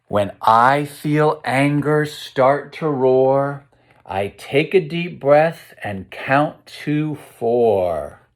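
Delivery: slow, lilting storytime verse; reading to children. A narrator's reading. Teacher reading the anger verse to the class, slow sing-song read-aloud. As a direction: let each rhyme land slowly for little listeners. Reads rhyming picture book aloud.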